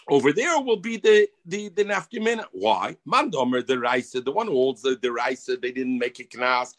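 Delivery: usual speaking voice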